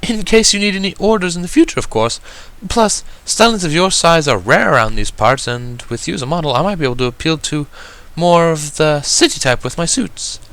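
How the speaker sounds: high, elegant lady explaining; a gracious designer selling her idea; refined Rarity voice. A narrator's reading. Rarity explaining to the guest, elegant and high.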